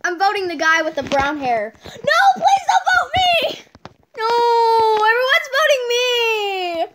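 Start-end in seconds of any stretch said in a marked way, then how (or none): 1.9-6.9 panicked laughing cry. Real, not acted. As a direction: beg loudly through laughter. Everyone votes her out.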